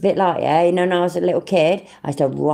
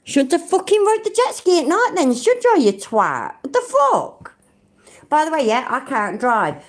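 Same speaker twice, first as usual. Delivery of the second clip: scornful mock rant; 11 semitones higher than usual. Mocking Chiller for jet ski.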